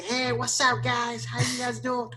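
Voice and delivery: high, put-on "Hey, what's up, guys?"; trying too hard to sound cool; fake cool American. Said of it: "si tú te pones a decir". (saludo gringo forzado, agudo y exagerado)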